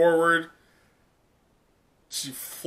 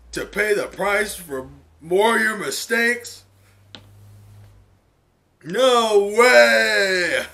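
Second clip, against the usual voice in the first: creepy stranger building from a sneer to a yell; villain's furious rant; 3 semitones higher, 7 dB louder than usual. Acted. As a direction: sneer it, then erupt. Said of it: "on this dude going".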